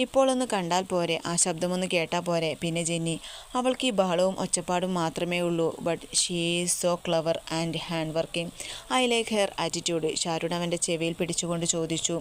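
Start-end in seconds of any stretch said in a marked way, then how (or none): none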